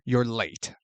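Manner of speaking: low, soft demonic hiss; a hint of a demon on the phone. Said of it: "a demonic voice tells her".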